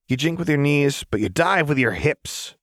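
high, playful instruction; teasing know-it-all girl; Jinx voice. Acted. Jinx gives flying tips.

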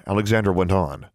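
ordinary delivery

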